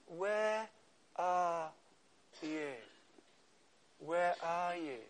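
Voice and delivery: soft, slow, searching "Where are you"; tender call. Pretend. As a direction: call gently like a loving father. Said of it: "Jesus is saying to you".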